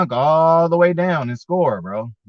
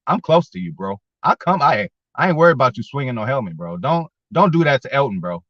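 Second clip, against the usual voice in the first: ordinary talk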